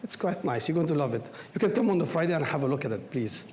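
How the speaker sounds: ordinary talk, nothing marked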